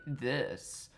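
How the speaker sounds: soft, put-on come-on; brief mocking purr; mock seductive. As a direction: imitate a seductive murmur. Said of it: "talking all seductively like".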